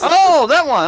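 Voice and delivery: high excited exclamation; mock excited. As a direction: burst out with fake delight.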